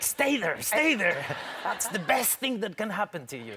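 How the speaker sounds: put-on high, laughing coax to the boy; playfully urging the toddler to stay put; telling toddler to stay. Father talking to his toddler son, high laughing coax. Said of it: "I was like".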